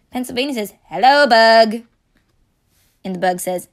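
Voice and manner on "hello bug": louder character voice for the state; louder put-on greeting. Pennsylvania greeting the bug, louder put-on voice. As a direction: boom a friendly hello.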